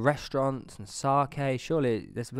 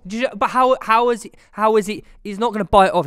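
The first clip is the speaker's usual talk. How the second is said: high, loud disbelief; incredulous; 9 semitones higher, 9 dB louder than usual. Real, not acted. Arguing with friends a zebra can't bite off an arm, high and loud. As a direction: protest it can't be true.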